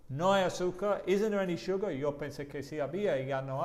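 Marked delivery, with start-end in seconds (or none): 0.1-1.9 "¿No hay azúcar?" and "Isn't there any sugar?" higher and louder; surprised tone. Shown as a demonstration. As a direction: sound surprised there is none. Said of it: "tiene que cambiar el tono de voz". (tono de sorpresa al preguntar)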